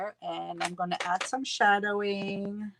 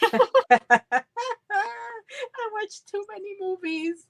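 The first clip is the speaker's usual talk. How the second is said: laughing; 12 semitones higher, 5 dB louder than usual. Real, not acted. Laughing at own joke.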